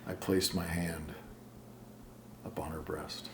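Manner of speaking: whispering. Acted. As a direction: drop to a breathy hush. Poem build-up whisper.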